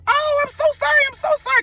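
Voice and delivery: high, squeaky panicked apology; frantic apology. Retold or imitated. Apologizing to the dream man after scratching his lip, high panicked voice. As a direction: yelp out sorry in a panic. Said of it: "and I was like".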